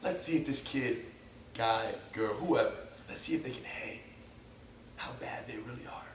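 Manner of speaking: low whispered scheming thought; hushed inner thought. Retold or imitated. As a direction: whisper it like a sneaky plan. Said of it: "the thought being".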